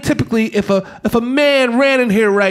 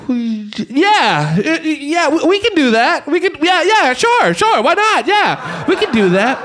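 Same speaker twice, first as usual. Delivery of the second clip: nervous agreeing; 6 semitones higher than usual. Retold or imitated. Nervous staff humoring a madman.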